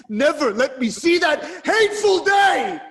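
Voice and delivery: high, loud cry to the heavens; desperate shout. Acted. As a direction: scream the plea at the gods. Oedipus begging the gods.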